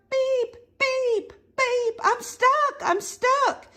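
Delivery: very high little truck distress call; crying out. A narrator's reading. Reader voices blue truck stuck in mud, high cry. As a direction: squeal it in a high, pleading pitch. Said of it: "cried the little blue truck".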